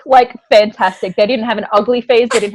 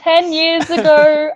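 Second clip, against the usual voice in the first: drawn out, raised storyteller start; slow dramatic opener; 7 semitones higher, 3 dB louder, much slower than usual. Real, not acted. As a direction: start the story grandly and slowly.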